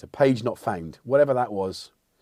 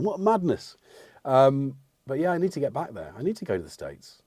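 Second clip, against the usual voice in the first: usual speaking voice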